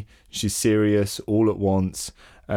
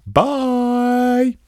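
cheery goodbye; 6 dB louder than usual. Real, not acted. Host signs off the podcast.

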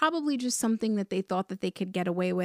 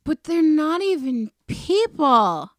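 incredulous outburst; 7 semitones higher, 8 dB louder, slower than usual. Real, not acted. Outraged joke about cartoon animals.

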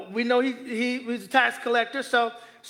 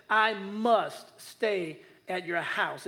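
very slow, lower and softer; slow emphatic repeat; 4 semitones lower, 4 dB quieter, much slower than usual. Shown as a demonstration. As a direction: press must gently. Emphatic repeat of key line.

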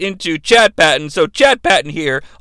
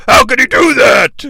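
a touch louder, put-on yell; mock Vader yell; 3 semitones higher, 5 dB louder than usual. Vader complaining about the suspension, mild mock yell. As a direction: lightly play the angry wrestler. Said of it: "Vader yells".

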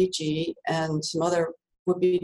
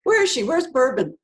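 calling out for her; 6 semitones higher, 3 dB louder than usual. Retold or imitated. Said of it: "And he goes".

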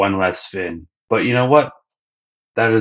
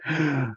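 realising gasp; 6 semitones higher, 8 dB quieter than usual. Retold or imitated. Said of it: "Sanji's like".

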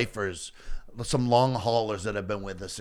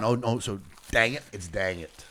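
normal talking voice

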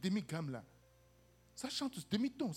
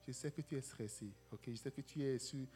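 softer, lower reassuring voice; calm her down gently; calm reassurance; 6 semitones lower, 7 dB quieter than usual. Retold or imitated. Preacher retells calming the stressed Mamie, soft reassuring voice. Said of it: "je lui ai dit".

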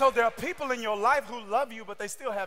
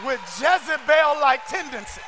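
louder, shouted sermon cadence; preaching at full force; 3 semitones higher, 8 dB louder than usual. Acted. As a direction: drive the point home, preaching. Preacher builds again to shouting.

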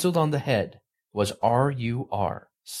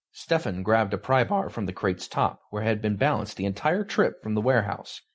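plain reading voice

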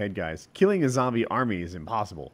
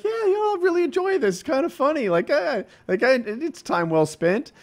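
much higher, put-on pleased voice; imitated pleased viewers; 12 semitones higher than usual. People watching his videos before going online, high mock contentment. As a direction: mimic easily pleased fans in a high voice.

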